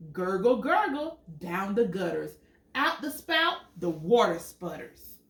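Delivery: lively storybook read, big high questions; animated picture book reading; reading to children. Acted. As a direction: read it out to little kids with wonder. Reads picture book aloud.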